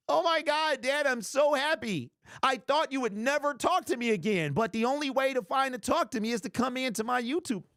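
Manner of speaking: high, overjoyed fake reunion; mock joy. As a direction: ham up tearful happiness. Pretend reunion with dad.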